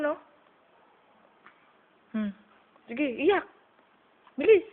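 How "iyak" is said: normal talking voice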